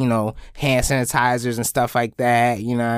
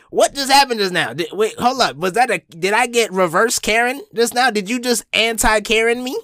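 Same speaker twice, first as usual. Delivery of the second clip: retold bafflement; 7 semitones higher than usual. Retold baffled reaction to compliment.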